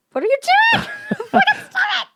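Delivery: high, shrieky protest through laughter; very high-pitched laughing outburst; laughing squeal. Real, not acted. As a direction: squeal it helplessly while cracking up. Laughing as screen effects misfire.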